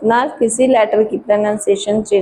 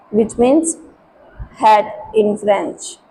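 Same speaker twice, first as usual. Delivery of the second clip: ordinary talk, nothing marked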